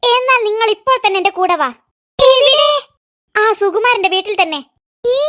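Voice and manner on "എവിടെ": sudden loud high "where?"; startled cry. Acted. Startled child asking aunt where, loud cry of surprise. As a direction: jolt up in alarm at the idea.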